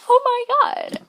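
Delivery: high overwhelmed squeal; squealing fan. Retold or imitated. Meeting her idol, high overwhelmed squeal. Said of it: "And I was like".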